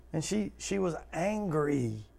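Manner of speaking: ordinary talk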